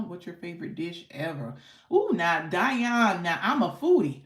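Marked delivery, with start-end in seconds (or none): 1.9-4.2 excited. Real, not acted. Host thrilled by food question.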